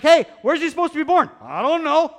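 high, loud comic questioning; hammed-up puzzlement; clueless exchange. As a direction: call it out in mock bewilderment. Acted exchange of puzzled searchers.

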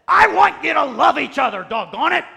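over-the-top shouted command; mock exasperated shout. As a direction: bark it like a fed-up father at his kids. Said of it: "That's how he said it".

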